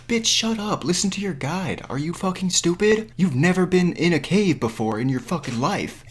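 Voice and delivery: high-pitched exasperated telling-off; outraged scolding. Acted. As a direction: tear into her for being stupid.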